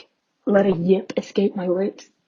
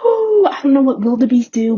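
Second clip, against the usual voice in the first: mock wail; 5 semitones higher, 7 dB louder than usual. Hammed-up feral rant.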